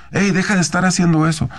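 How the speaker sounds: imitating a parent who scolds instead of speaking with love; harsh scolding. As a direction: snap at them the way we shouldn't. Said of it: "No, lo hacemos con amor".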